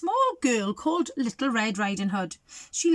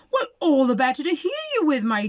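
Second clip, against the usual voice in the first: mock growl; 4 semitones higher, 6 dB louder, faster than usual. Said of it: "growled the wolf".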